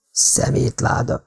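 soft, slow, almost voiceless; muttered thought. A narrator's reading. Ken privately cursing Art, muttered as a thought. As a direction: curse him under your breath. Ken's silent insult about Art.

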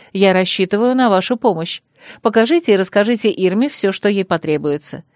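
plain reading voice

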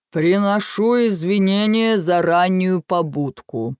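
slow, booming giant's rumble; a voice that shakes the ground; rumbling giant voice. A narrator's reading. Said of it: "пророкотал сотрясающий землю голос".

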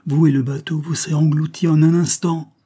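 crying out. A narrator's reading. Villagers warning Wilfrid off the sea, crying out. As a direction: call out an urgent warning. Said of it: "cinquante voix lui crièrent".

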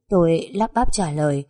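plain reading voice

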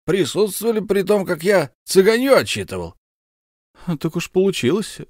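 put-on high, hearty bragging old soldier; boastful count Bekker. Count presents his comrade to his wife, high and proud. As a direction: show off your war comrade.

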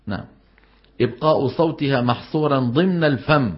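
normal talking voice

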